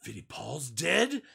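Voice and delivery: a grieving fan hearing the news late, stunned; stunned disbelief. Retold or imitated. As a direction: ask it as if the news just hit. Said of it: "the guy went".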